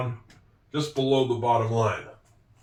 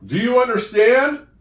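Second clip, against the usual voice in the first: animated quiz; 7 semitones higher, 7 dB louder than usual. Shown as a demonstration. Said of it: "demo question and answer".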